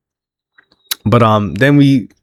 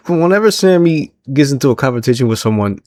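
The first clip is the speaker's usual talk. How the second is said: ordinary talk, nothing marked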